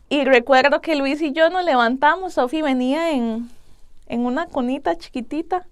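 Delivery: ordinary talk, nothing marked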